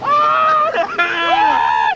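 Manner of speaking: screaming. Real, not acted. Drenched in car wash.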